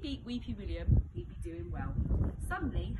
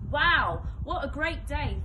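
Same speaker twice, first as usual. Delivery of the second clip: higher, louder delighted exclaim; gleeful; 5 semitones higher, 11 dB louder, slower than usual. A narrator's reading. Henry thinking about his win, gleeful. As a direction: savour the victory out loud.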